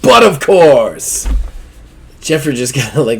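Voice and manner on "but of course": lightly mocking echo, slower and louder; imitating posh reply. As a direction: parrot the haughty line back. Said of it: "echo of the player".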